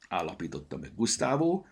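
ordinary delivery, nothing marked

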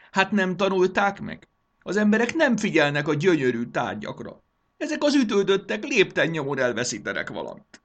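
high, loud indignant tirade; frantic, hammed-up outrage; outraged rant. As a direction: fume at full voice. Ruler rants over his missing objects.

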